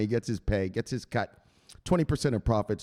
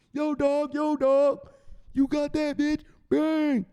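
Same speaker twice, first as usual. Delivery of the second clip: much higher excited bro shout; hyped buddy call; 14 semitones higher, slower than usual. Acted. Buddies celebrating the win, high hyped shout. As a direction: hype your buddy up.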